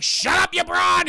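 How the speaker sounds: high, loud hammed-up husband outburst; mock angry yell. Bitter husband to his wife over the holiday, mock angry shout. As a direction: yell it as a fed-up old husband.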